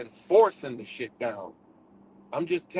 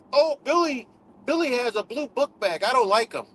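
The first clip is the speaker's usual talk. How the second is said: higher mock kid whining about Billy; childish voice; 6 semitones higher than usual. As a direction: play a five-year-old being petty. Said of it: "go back to childhood to five years old".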